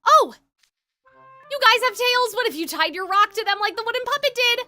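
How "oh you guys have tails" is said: surprised. Acted. Sudden discovery of tails.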